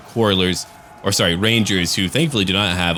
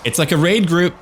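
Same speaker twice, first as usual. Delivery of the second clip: excited cheering; 9 semitones higher than usual. Real, not acted. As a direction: cheer them on, pumped up. Cheering on his unit.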